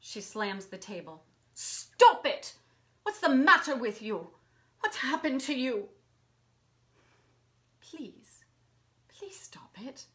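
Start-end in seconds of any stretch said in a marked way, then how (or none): 1.5-5.9 loud, high burst of exasperation; angry outburst. Acted. Angry outburst as she slams table. 7.8-10.0 very soft, drained begging after an outburst; hushed plea. Acted. Pleading after her outburst.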